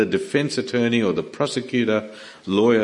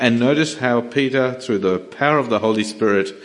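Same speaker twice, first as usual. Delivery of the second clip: usual speaking voice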